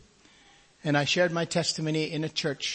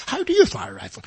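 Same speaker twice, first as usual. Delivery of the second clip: incredulous question; 4 dB louder, faster than usual. Retold or imitated. Doctor questioning, rising disbelief.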